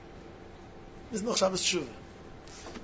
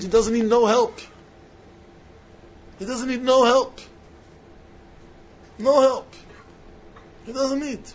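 higher and louder, hammering "no help"; emphatic insistence; 6 semitones higher, 5 dB louder than usual. Real, not acted. Preacher telling students God needs no help, loud insistence. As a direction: drive it home with rising force.

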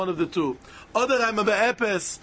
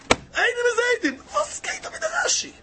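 mock questioner. Voicing a challenging questioner.

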